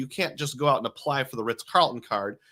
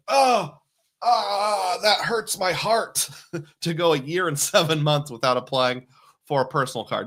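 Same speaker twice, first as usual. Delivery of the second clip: high, whiny mock heartbreak; mock heartbroken viewer; 4 semitones higher than usual. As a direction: ham up a pained sigh over it. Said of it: "I'm sure he's like".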